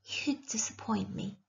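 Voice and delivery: hushed, soft judgement; whisper. A narrator's reading. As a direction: lean close and murmur it softly. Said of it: "She whispered".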